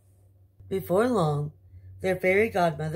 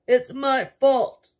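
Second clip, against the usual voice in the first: wailing king; 8 semitones higher, 8 dB louder, slower than usual. Acted. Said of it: "wailed the king".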